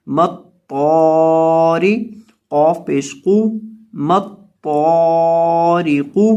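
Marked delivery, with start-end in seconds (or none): 0.1-1.9 تو heavy and held very long, ری thin; slow chanted مت تو ری; tajweed recitation. Shown as a demonstration. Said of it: "را کو بریک پڑھیں گے". 4.1-6.3 تو heavy and held very long, ری thin; slow chanted مت تو ری; tajweed recitation. Shown as a demonstration. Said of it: "را کو بریک پڑھیں گے".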